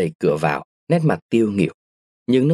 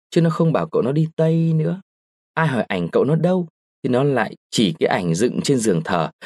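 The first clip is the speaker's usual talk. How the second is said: ordinary delivery, nothing marked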